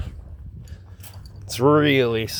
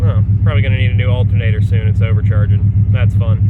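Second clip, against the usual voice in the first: raised voice over engine; 2 semitones higher, 8 dB louder than usual. Real, not acted. Speaking over the running engine.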